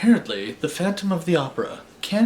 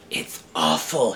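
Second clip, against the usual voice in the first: whisper; 6 semitones lower than usual. A narrator's reading. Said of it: "he whispered".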